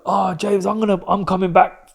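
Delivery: high, pumped-up retelling of mum; loud, upbeat comeback pep talk; hyped-up. Retold or imitated. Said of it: "She was on such a hype thing".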